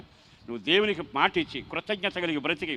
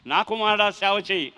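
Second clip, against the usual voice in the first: higher, louder solemn call; voice of the Lord; 5 semitones higher, 5 dB louder than usual. Retold or imitated. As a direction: announce it like a divine summons. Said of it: "ప్రభు స్వరం వినబడింది". (ప్రభువు స్వరంలా గంభీరంగా పిలుపు)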